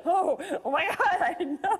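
high, drawn-out laughing dismay; hysterical, giggly retold shock; freaked out. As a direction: wail it in mock panic through giggles. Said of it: "I was so freaked out".

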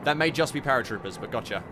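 ordinary delivery

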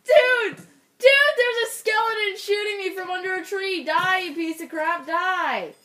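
high, loud panicked outburst; frantic battle cry; alarmed yelling. Real, not acted. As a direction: start at a shriek, easing into high frantic rant. Shot at by skeleton, attacking back.

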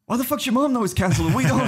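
high-pitched mock outrage; incredulous retort. Friend's baffled retort, retold.

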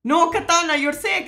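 loud, high cry of dismay; startled outcry. Real, not acted. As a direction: shout it in sudden disbelief. Startled reaction to game dialogue.

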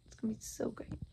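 soft unvoiced whisper; whispered longing for bed. Real, not acted. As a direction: breathe it out as a secret.

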